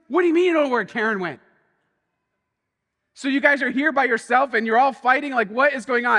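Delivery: high, loud, fast alarmed questions; imitating his own frantic disbelief; retold panic. Youth worker confronting the girls, loud and frantic. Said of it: "I'm like".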